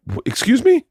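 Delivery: high rising indignant question; taken aback, voice jumping high; affronted disbelief. Retold or imitated. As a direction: imitate an offended boss, pitch leaping up.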